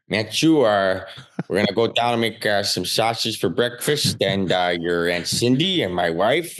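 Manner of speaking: put-on thick South Side Chicago accent; Uncle Mike. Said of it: "it was my Uncle Mike".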